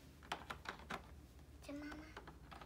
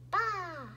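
lamb bleat; 4 semitones higher, 7 dB louder than usual. Pretend. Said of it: "The lamb say".

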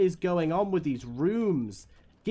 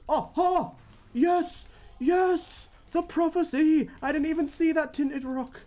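real excitement; 8 semitones higher than usual. Real, not acted. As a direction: yell it out, overjoyed at the lucky find. Finds the prophecy item.

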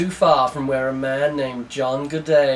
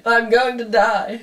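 hammed-up dying man; 8 semitones higher, slower than usual. Dying terrorist, higher second take.

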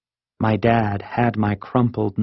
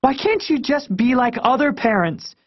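put-on very high, wailing kid demand; angry demand. A narrator's reading. Kid demanding answers from parents, distraught. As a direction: plead and accuse at the top of the range. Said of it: "I demanded".

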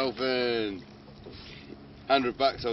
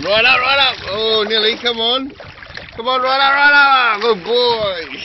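high, loud, whooping encouragement; excited cheering; 10 semitones higher, 11 dB louder than usual. Real, not acted. Man cheering a dolphin up out of the water, excited. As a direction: urge it up at full voice, thrilled.

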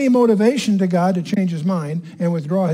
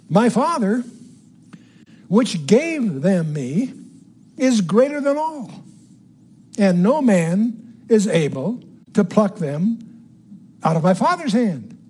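slow, measured Bible reading in separated phrases; emphatic scripture reading; 3 semitones higher, slower than usual. Acted. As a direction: read the verse out deliberately, phrase by phrase. Reads scripture aloud to teach.

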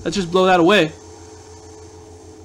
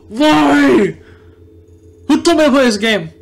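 high frustrated wail; angry outburst; 6 semitones higher, 3 dB louder than usual. Real, not acted. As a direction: wail in exasperation at losing. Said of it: "I'm genuinely angry".

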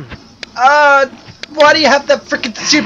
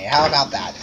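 quieter take; 8 semitones lower, 7 dB quieter than usual. Shown as a demonstration. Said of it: "How would you like me to say".

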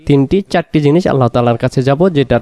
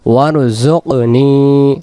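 slow recitation; much slower than usual. Shown as a demonstration. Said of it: "teacher recites word slowly".

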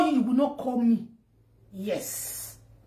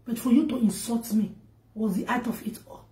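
ordinary talk

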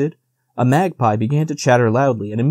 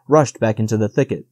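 plain reading voice

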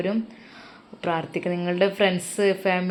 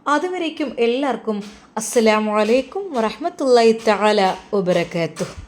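story sign-off; 3 semitones higher than usual. A narrator's reading. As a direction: wrap up the tale for listeners. Story closing and greeting. (കഥയുടെ സമാപന ശൈലി)

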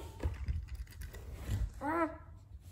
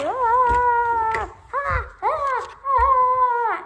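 screaming cries; 2 semitones higher, 5 dB louder, much slower than usual. Pretend. Toy character in trouble.

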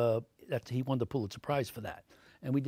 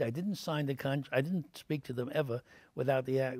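ordinary delivery

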